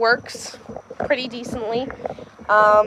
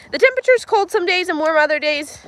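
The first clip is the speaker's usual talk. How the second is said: ordinary talk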